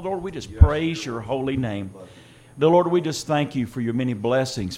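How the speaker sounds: normal talking voice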